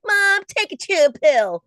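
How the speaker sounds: put-on teen sassing her mad mom; sassy kid reply. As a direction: drag it out like a bratty teen. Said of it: "And I'm like".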